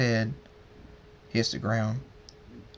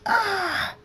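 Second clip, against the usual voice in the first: high, short scream; cry of pain; 4 dB louder than usual. A narrator's reading. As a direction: yelp high as the blow lands. Struck in a fight.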